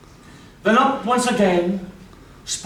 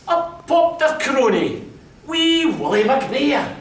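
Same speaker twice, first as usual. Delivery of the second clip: plain reading voice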